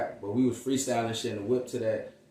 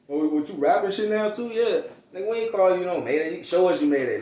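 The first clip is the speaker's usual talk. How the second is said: mocking; 8 semitones higher, 7 dB louder than usual. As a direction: tease him like old friends who don't buy it.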